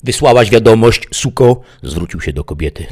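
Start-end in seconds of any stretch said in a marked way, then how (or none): 0.0-1.6 loud, high bullying question; rough demand. A narrator's reading. Bandit turns to the woman, loud rough demand. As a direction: demand an answer harshly.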